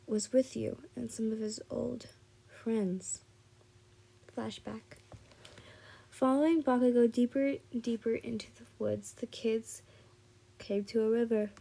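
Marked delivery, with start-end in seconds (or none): none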